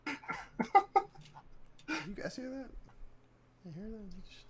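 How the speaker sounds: teasing a friend who froze; mock high voice. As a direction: tease him in a squeaky voice. Mock computer voice.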